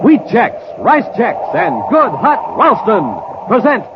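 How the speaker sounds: ordinary talk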